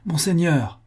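lightly called-out address; calling out. A narrator's reading. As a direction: hail a grandee across the room. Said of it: "appela-t-il".

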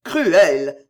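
loud cry of reproach; rejected lover shouting at her, anguished. Acted. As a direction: hurl the word at her. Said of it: "s'écria-t-il".

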